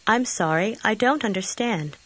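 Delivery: ordinary talk, nothing marked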